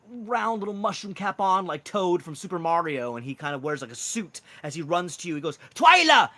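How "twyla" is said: high, loud Welsh shout; Welsh accent. Acted. Mushroom man runs up to Twyla, shouting in a Welsh accent. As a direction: holler the name in a Welsh accent. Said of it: "He speaks in, like, a Welsh kind of accent".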